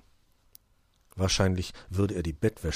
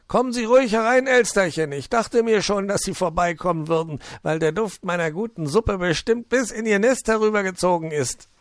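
high, loud, jolly fox voice; high-pitched welcoming fox; cheerful fox; 13 semitones higher, 8 dB louder than usual. Acted. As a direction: play the hospitable old fox, beaming.

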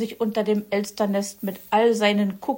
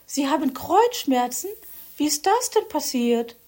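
curious Frau Elster; 7 semitones higher than usual. A narrator's reading. Elster asks Fuchs.

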